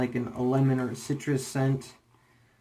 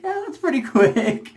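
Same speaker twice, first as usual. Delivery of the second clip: laughing; 13 semitones higher, 7 dB louder than usual. Retold or imitated. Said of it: "and I was like".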